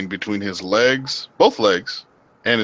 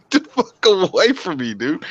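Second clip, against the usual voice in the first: high, mock-outraged shove-off; mock get-away; 10 semitones higher than usual. Pushing away a curious Japanese guy, mock outrage. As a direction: bark it like recoiling from a pest. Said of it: "And I'm like".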